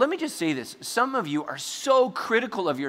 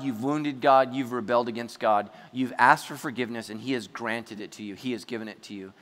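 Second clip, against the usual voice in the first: ordinary talk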